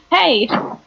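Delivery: mock yell. Player yelling from atop a desk, mock yell. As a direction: call out as if yelling across the room. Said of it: "I yell at the top of my lungs".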